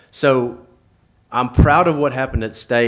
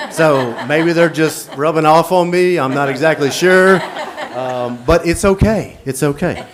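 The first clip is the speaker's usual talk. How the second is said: laughing; 5 semitones higher, 6 dB louder than usual. Real, not acted. Coach laughs through emotional speech.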